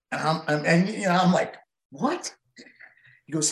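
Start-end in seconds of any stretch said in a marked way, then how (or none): 1.9-2.3 high-pitched retold "what?"; imitated shock, voice jumping up; startled disbelief. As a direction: react as if blindsided. Retold shock at being called late.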